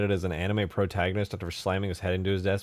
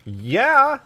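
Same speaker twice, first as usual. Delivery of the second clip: excited shout; 15 dB louder than usual. Acted. Sarcastic overexcited outburst.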